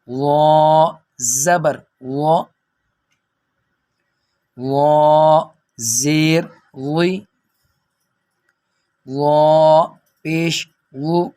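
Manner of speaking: وا drawn out slowly, heavy, with no whistle; thick full sound. Shown as a demonstration. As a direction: spell out وا slowly, rounded and free of z buzz. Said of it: "اس کو موٹا پڑھنا ہے". (موٹی آواز میں ظا کے ہجے)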